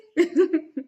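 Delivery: high, loud little laugh; giggle. Real, not acted. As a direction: giggle brightly after the goodbye. Laughs after signing off.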